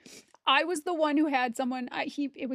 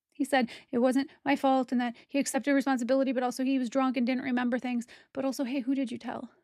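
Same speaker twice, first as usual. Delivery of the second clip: ordinary talk, nothing marked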